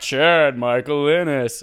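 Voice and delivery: slow, drawn-out name callout; SNL announcer voice. Pretend. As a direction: stretch the name like a show opener. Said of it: "We should have an S N L intro".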